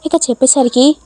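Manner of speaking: ordinary delivery, nothing marked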